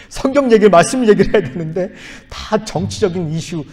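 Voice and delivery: laughing, high and loud on the first words. Real, not acted. Pastor laughing to the congregation about a political sermon. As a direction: laugh it out in disbelief.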